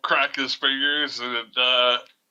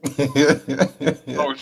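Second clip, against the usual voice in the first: laughing; 4 semitones higher, 5 dB louder than usual. Real, not acted. Laughing while searching.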